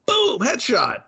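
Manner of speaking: high-pitched gamer-style announcement; mock triumphant call. Voicing Howard right after the shot, mock triumphant call. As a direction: call the kill in a playful high voice.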